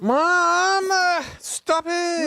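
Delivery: loud, high drawn-out protest; whining child. Acted. Child protesting to Mom in game scene, hammed-up whine. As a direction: wail at Mom like an embarrassed kid.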